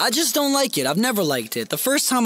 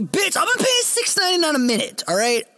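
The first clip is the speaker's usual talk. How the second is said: fast, high-pitched mock ranting; indignant protest; 13 semitones higher, 4 dB louder, faster than usual. Paying man to client offering to go, indignant protest. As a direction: fire it back, high and quick.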